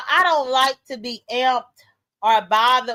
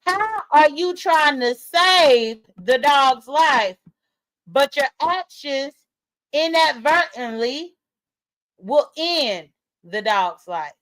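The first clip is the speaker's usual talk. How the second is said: very slow, deliberate, each phrase set apart; spelled out slowly; 3 semitones higher, slower than usual. Shown as a demonstration. Streamer repeating her point for slow people in the back, slow and emphatic. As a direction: say it as if for slow listeners, pausing between phrases. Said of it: "for the slow people in the back".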